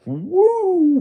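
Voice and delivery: high, loud mocking whoop; old man jeering at an online critic with a loud, high whoop. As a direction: let out a jeering woo. Mocking someone losing it.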